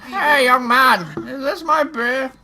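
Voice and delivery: high, loud put-on character voice; calling out. Game character hailing a young man, high loud calling voice. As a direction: call out high and loud, drawing out the end.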